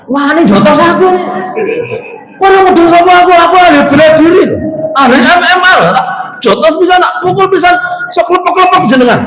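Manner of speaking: high, loud cocky brawler; boastful fighter. Retold or imitated. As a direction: puff up and threaten. Mock braggart threatening to punch.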